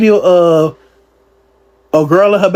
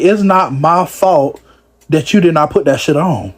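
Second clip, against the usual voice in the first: ordinary talk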